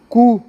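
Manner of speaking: कू said as koo, the p silent; correct pronunciation. Shown as a demonstration. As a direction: say कू, dropping the p entirely. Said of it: "वाजिब में जो उच्चारण उसका कू होता है".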